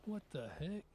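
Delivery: baffled, rising what the heck; surprised. Real, not acted. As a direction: caught off guard by the miss. Reacting to a missed shot.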